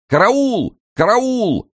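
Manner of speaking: very high, ringing cry; shouting for help. A narrator's reading. Inmate at cell door calling guards, shouting. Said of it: "у двери и кричал".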